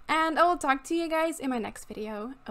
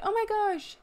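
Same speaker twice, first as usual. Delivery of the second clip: usual speaking voice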